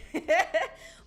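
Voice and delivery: high bursting laugh; giddy laugh. Real, not acted. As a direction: laugh it off cheekily. Laughs at own unapology.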